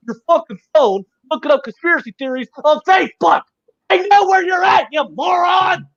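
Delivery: rising furious yelling at a conspiracy theorist; angry rant. Real, not acted. Ranting at a conspiracy-believing friend, furious shouting. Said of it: "fucking heated tonight".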